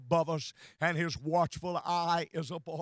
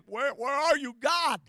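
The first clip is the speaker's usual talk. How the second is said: higher, rising plea, retold; crying out to God; 7 semitones higher, 5 dB louder than usual. Jesus on the cross asking for God, crying out. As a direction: call upward in mock anguish. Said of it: "Jesus was saying".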